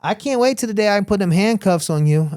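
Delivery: lightly voiced taunting sheriff; cop taunting. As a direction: needle him in public. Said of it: "He's like".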